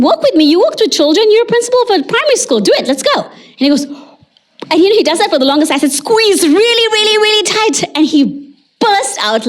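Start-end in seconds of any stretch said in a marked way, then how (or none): 0.0-3.2 high, insistent coaxing; urging. Retold or imitated. Therapist coaxing a former principal to join in, urging. 5.9-7.8 high, drawn-tight coaching voice; straining encouragement. Retold or imitated. Therapist coaching the patient through an exercise, high and straining.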